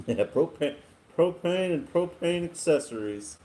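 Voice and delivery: hammed-up Hank Hill selling propane; Hank Hill impression. To the stream chat, a light Hank Hill voice. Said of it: "My name's Hank Hill".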